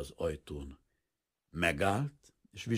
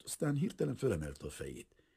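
ordinary delivery

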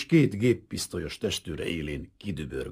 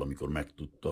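ordinary delivery

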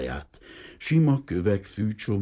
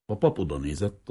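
ordinary delivery

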